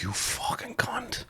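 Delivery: slow, whispered retold curse; hushed curse. Man left alone by his friend, hushed curse. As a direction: curse him under your breath. Cursing departing friend under breath.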